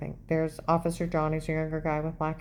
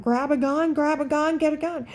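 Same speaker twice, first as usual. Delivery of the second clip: higher, hurried mock panic; mock frantic; 9 semitones higher, 4 dB louder than usual. Mimicking Dave in the car, frantic mock panic. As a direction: parrot his frantic babbling.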